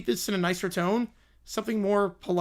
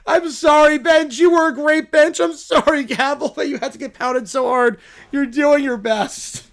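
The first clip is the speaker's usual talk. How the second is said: shouted apologies; 4 semitones higher, 7 dB louder than usual. Acted. Lawyer dragged out, yelling apologies.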